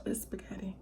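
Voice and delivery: hushed whispered reveal; whispering. Real, not acted. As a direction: whisper the word spaghetti.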